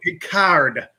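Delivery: slow "card" with full r and hard D; over-pronounce the end of "card"; drawn-out "card", every sound given. Retold or imitated. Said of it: "pronounce it out with the hard D".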